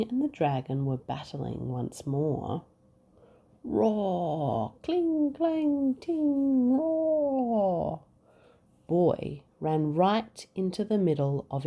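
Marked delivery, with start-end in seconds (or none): 3.6-8.0 high, bright roar and clang calls; battle noises. Acted. Battle resumes.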